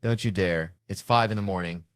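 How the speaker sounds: ordinary delivery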